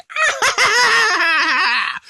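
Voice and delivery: high shrieking villain cackle; Babidi cackle. Retold or imitated. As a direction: cackle like a tiny evil wizard. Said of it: "Babidi's like".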